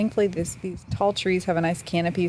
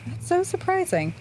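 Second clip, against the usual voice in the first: much higher, amazed; surprised; 12 semitones higher than usual. Real, not acted. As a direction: sound genuinely amazed. Surprised stat reaction.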